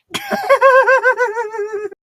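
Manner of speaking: big squealing burst of laughter; high laugh bursting out; loud high laugh. Real, not acted. Explodes laughing at Samurai Potter joke.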